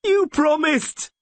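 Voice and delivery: high, drawn-out desperate scream; slow scream of a dying man. A narrator's reading. Dying Vilis reminds Rayla of her promise, screaming. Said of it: "he screamed indistinctly".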